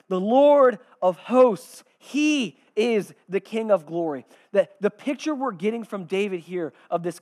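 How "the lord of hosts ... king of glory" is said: loud, slow, ringing proclamation; herald's answer. Acted. Herald responding to the gatekeepers, slow loud proclamation. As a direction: announce it with full force, drawing out each phrase. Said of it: "And the herald responds".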